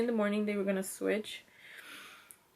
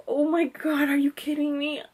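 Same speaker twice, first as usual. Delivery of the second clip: higher, louder retold excitement; excited, raised-pitch exclamation; happy disbelief; 6 semitones higher, 4 dB louder than usual. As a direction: re-enact thrilled disbelief at good news. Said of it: "I was so happy".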